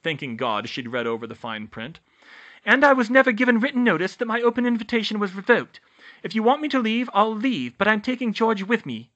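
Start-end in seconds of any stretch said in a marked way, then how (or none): 2.6-9.1 high, loud, firm argument; insistent protest, pitched up; defiant young witch. A narrator's reading. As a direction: stand your ground in a raised high voice. Hermione argues back.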